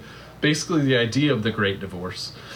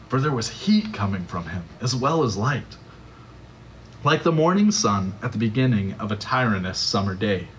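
ordinary talk, nothing marked

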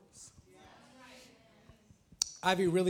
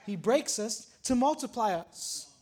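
normal talking voice

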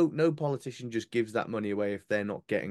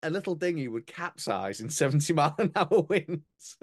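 louder and higher, laughing through the words; laughing while talking; 7 semitones higher, 4 dB louder than usual. Real, not acted. Mocking the minister's boast, laughing in disbelief. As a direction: laugh in disbelief as you tell it.

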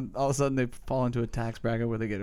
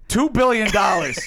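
loud demand; 8 semitones higher, 7 dB louder than usual. Pretend. Mock negotiation, asking price.

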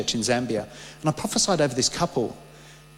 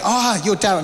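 higher, louder eager greeting; surprised recognition; 5 semitones higher, 3 dB louder than usual. Retold or imitated. People greeting him by name, surprised. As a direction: mimic a fan spotting someone.

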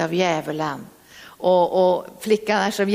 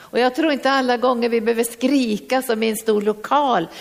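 ordinary talk, nothing marked

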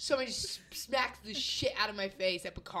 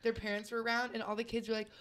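ordinary talk, nothing marked

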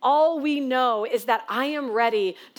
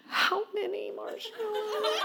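put-on very high sniffly child gasp, softer; hopeful child gasp; 10 semitones higher, 9 dB quieter, slower than usual. Tearful daughter offered marshmallows, high sniffly hopeful gasp. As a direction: gasp then ask in a tearful little-girl voice.

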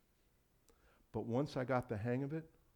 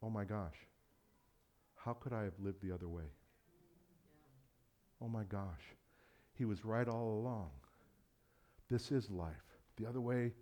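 softer, hushed wonder; awed realization; 2 semitones lower, 9 dB quieter than usual. Retold or imitated. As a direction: quietly realize it was true all along. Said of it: "you go".

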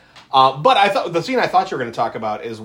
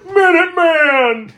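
a buddy's drawn-out taunting shout, much higher; mocking yell; 13 semitones higher, 7 dB louder, slower than usual. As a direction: yell it across the lot, high and loud. Guy yells nickname at friend.

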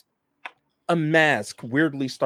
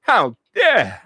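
indignant Archibald; 7 semitones higher, 6 dB louder, slower than usual. Acted. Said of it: "Archibald's like".

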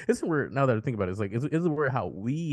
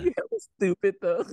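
mock taunt; 12 semitones higher than usual. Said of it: "teasing about hella".

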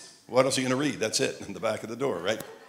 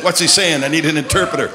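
loud, high comic complaint; mock bafflement; 3 semitones higher, 12 dB louder than usual. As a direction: call out in joking confusion. Voices a baffled God, joking.

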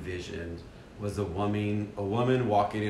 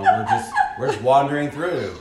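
laughing through the words; nervous laugh while talking; 6 semitones higher, 10 dB louder than usual. Real, not acted. Laughing while telling nervous wandering.

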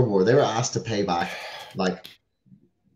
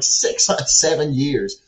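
ordinary talk, nothing marked

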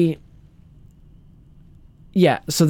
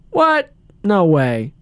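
feigned surprise; 4 semitones higher, slower than usual. Retold or imitated. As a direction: act as if you had no idea. Retold suspect's feigned shock.

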